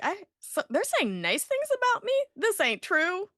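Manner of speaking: a lightly hammed-up high voice; high mock Pete voice. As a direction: play him as a squeaky insecure dad. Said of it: "he's like".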